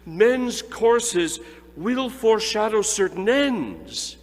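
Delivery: loud, high, slow spectral pronouncement; the silent spirit finally pronounces doom; solemn ghost. A narrator's reading. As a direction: intone it like a doom-laden phantom. The ghost answers Scrooge.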